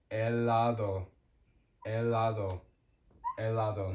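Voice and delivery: "helado" as eh-LAH-do, no h breath; silent H. Shown as a demonstration. Teacher giving the word for ice cream, "helado" with silent H. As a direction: open "helado" straight on the vowel. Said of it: "The H is always silent".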